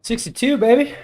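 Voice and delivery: excited cheer. Real, not acted. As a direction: celebrate the score out loud. Celebrating a better typing score.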